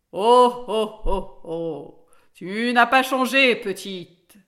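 big booming oak tree, loud drawn-out start; deep, slow voice. A narrator's reading. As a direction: heave out each word like an ancient tree. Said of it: "une voix grave et lente".

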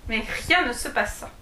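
ordinary delivery, nothing marked

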